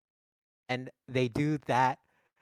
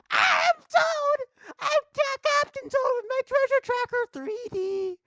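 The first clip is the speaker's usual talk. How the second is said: piercing high falsetto screech; Toad; 8 dB louder, slower than usual. Acted. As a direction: shriek it like a tiny hammed-up cartoon creature. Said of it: "And Toad's like".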